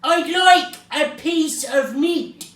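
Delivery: slow, high booming order; booming shout. A narrator's reading. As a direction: boom it out slowly at full volume. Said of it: "boomed Mister Noisy".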